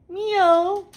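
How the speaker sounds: high, loud call to a pet; calling the cat. Real, not acted.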